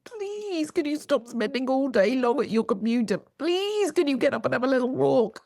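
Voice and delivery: high-pitched pleading little voice; imploring, put-on voice of a nagging body; whispering fascia's plea. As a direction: beg with a long, squeaky please. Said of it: "is the fascia whispering at you".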